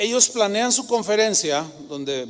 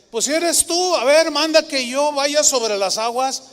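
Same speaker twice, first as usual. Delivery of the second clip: eager Peter; 7 semitones higher, 4 dB louder than usual. Retold or imitated.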